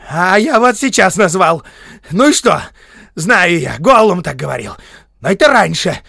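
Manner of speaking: high, loud, heated retorts; furious, possessive hobbit yelling; defiant shouting. A narrator's reading. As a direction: build to a piercing claim of ownership. Bilbo defiantly shouts back at wizard.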